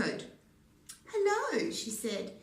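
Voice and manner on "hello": high, loud bright hello; phone hello. A narrator's reading. Red answers phone.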